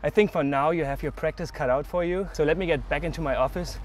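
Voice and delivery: panting after skating hard; winded, gasping between words; out of breath. Real, not acted. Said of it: "I'm super winded".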